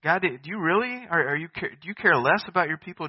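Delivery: voiced disbelieving complaint; incredulous questioning. Voicing incredulous questions to God.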